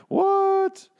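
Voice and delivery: very high squeaked disbelief; mock surprise. Husband feigns surprise at his wife's accusation, high squeal.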